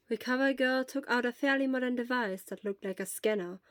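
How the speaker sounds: ordinary delivery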